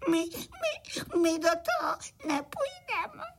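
high, sobbing little voices, stammering the first word; tearful. A narrator's reading. Frightened little crickets refuse the cart ride, crying. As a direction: wail it out, stretching the end. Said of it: "malí cvrčci rozplakali".

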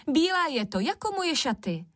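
high-pitched storybook squabble; quarrelsome shouting. A narrator's reading. Second sister contradicting the first, quarrelsome high cry. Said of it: "zase dali do křiku".

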